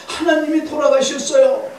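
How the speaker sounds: very high, loud retold outcry; acted insistent cry. Wife insisting to Luther, high loud acted outcry. Wife repeats news more forcefully.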